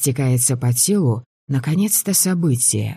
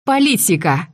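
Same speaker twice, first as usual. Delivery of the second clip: громкое пьяное объявление; объявить на весь костёр; громко объявила; 5 semitones higher than usual. A narrator's reading. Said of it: "громко объявила она". (громко, заплетающимся языком)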